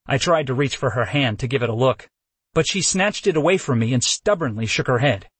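usual speaking voice

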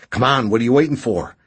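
high, loud, fast prodding; impatient urging. A narrator's reading. Injured man hurrying his hesitating rescuer, impatient. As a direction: hustle him along quickly. Burbage impatiently urges Redrick to move.

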